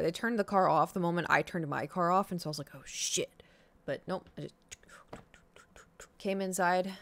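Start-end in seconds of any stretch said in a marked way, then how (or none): 2.7-3.4 whispered curse. Retold or imitated. Whispered dismay.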